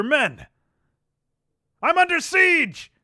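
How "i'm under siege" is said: alarmed call. Acted. Commander under attack, alarmed.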